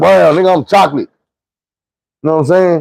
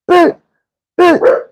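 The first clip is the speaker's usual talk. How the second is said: high, abrupt exclamations; punched-out insult. Retold or imitated. Sister hitting the girl with uppercuts, punched-out insult. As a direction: spit each word like a landing blow, pitched high.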